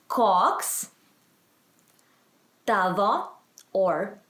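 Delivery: Koks, long pause, tavo, each rising; language-teacher model. Shown as a demonstration. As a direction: teach Koks tavo one word at a time. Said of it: "let's go that again".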